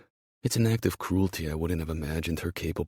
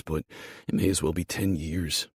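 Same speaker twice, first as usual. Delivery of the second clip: plain reading voice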